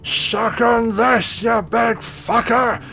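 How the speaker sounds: high, slow, shouted taunt. Acted. Villager Tam Tamson shouting a taunt at the angel, high slow yell. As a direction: bellow it across the battlefield. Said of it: "Tam Tamson's voice upraised going".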